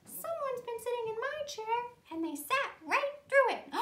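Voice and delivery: high-pitched tiny bear voice; little wee bear. Acted. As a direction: squeak it out like a baby bear. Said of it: "And the little wee bear said".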